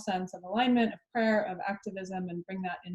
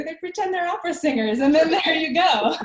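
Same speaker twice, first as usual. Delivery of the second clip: high, fast, laughing through words; 6 semitones higher, 9 dB louder than usual. Real, not acted. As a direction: laugh out the punchline. Joking about opera singers.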